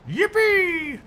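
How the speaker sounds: high-pitched drawn-out yippee; excited cheer. Real, not acted. Sudden streamer cheer.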